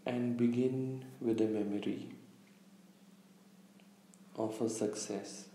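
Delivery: calm meditation guide, long pauses between phrases; unhurried, slightly hushed meditation delivery; guided visualization. Real, not acted. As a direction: lead listeners gently inward, leaving space after each instruction. Leads a guided visualization exercise.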